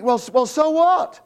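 high, loud sarcastic so what; mock shrug of a bored skeptic. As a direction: lampoon the shrug. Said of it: "but we think".

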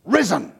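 Risen called out high and loud, one ringing word; a single triumphant Risen; proclaimed Risen. Shown as a demonstration. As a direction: announce Risen like a herald. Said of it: "he says it, just one word".

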